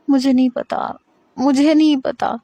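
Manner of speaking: crying out that she doesn't know; sobbing. Acted. Hira, crying, tells Armaghan she doesn't know. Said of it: "سسکیاں بھرتے ہوئے، روتے ہوئے".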